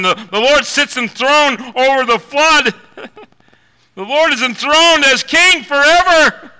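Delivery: loud, high proclamation, stress king; preaching at full force. Real, not acted. Preacher reading verses to the church, full-force preaching. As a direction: proclaim the Lord's throne.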